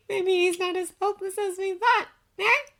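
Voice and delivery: high, giggly teasing voice; giggling woman. A narrator's reading. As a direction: giggle first, then tease up high. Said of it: "The young woman giggled".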